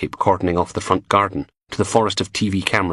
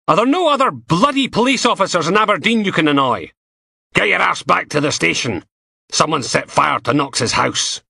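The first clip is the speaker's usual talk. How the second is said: louder, higher, impatient boss; gruff inspector; 9 semitones higher, 3 dB louder than usual. A narrator's reading. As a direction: bark orders down the phone, annoyed. Steele barking down the phone.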